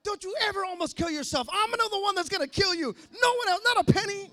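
loud, high, fast furious scolding; furious mom scolding. Retold or imitated. Mom scolds her son after the hospital, loud and furious. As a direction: scold like a mom who was terrified.

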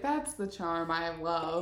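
soft mock Rufus. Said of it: "He's like".

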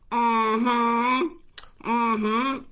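high, loud knowing hum with a rising tune; smug mm-hmm. Retold or imitated. Rival stirring up the couple's fight, loud smug mm-hmm. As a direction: egg them on with a smug mm-hmm. Quiznos Dan stirring trouble.

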